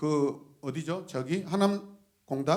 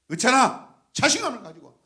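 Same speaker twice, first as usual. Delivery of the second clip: high, loud call then urging; rally him with confidence; calling out encouragement; 9 semitones higher, 10 dB louder than usual. Real, not acted. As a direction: pastor cheering a member on.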